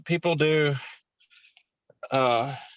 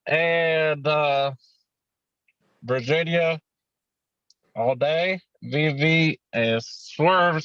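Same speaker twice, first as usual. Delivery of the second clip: normal talking voice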